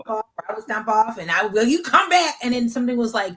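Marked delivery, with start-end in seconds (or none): 1.6-2.3 put-on raised, high call after a kid who stomped off; mock mom call. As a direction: order him back into the room. Mom calling son back in quarrel.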